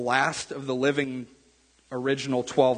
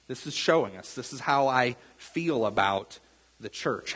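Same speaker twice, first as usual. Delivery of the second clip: ordinary talk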